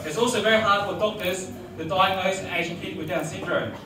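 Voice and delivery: normal talking voice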